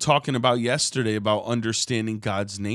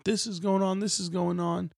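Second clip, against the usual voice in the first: higher, lightly sung-out news calls; hawking headlines; 8 semitones higher than usual. Retold or imitated. Preacher imitates paper boys calling out news, higher pitched. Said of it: "they would tell you about the day's headlines".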